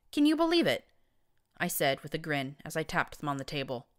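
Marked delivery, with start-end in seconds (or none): 0.1-0.9 high, incredulous exclamation. Acted. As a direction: toss it up in mock disbelief.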